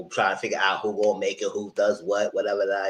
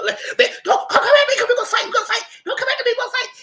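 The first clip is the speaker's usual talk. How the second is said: squealing high-pitched mock threat; mock Danielle threat; 6 dB louder, much faster than usual. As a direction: shriek it like a fired-up player squaring up. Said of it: "and Danielle said".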